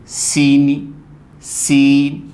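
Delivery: सीनी, slowly, with सी held long; long first syllable. Shown as a demonstration. Teacher asks students to listen, long first syllable. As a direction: draw out सी, keep नी short. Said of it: "सी long जा रहा है".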